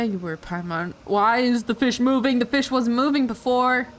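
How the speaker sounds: normal talking voice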